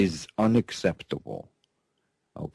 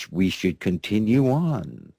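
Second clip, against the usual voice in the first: normal talking voice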